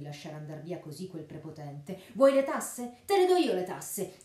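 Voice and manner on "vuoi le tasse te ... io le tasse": high, loud furious yell; furious shout. A narrator's reading. Friar yelling at the sheriff while beating him. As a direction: roar it as you swing the stick.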